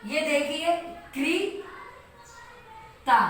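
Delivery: क्रीता, क्री को लंबा खींचकर धीरे; 'क्रीता' को क्री की लंबी ई से धीरे बोलो; क्री उच्चारण. Shown as a demonstration. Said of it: "इसको हम क्री पढ़ेंगे". ('क्रीता' धीमे, लंबी ई के साथ)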